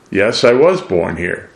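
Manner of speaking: ordinary talk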